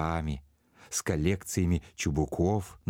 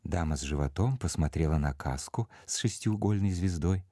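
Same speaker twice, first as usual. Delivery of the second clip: ordinary delivery, nothing marked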